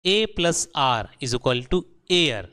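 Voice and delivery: very slow, each word spaced out; teacher drilling a rule. Shown as a demonstration. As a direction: spell the rule out slowly. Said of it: "हमेशा आपको याद रखना है".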